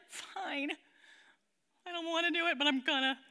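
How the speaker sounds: put-on sulky, childish giving-in; obey with a reluctant pout. Preacher showing how we obey God grudgingly, pouting. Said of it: "you ever obey pouting?".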